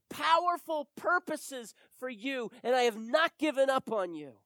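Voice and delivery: impassioned, high, very loud proclamation; full-force preaching. Real, not acted. Preacher proclaiming God has not given up on you, full-force preaching. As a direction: drive each phrase home to the congregation.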